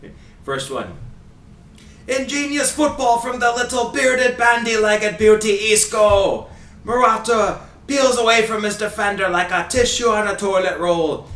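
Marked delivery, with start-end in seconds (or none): 2.1-11.3 put-on high, loud Scottish commentator accent; over-the-top commentator impression, Scottish accent pitched way up; Ray Hudson accent. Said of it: "do my best Ray Hudson accent".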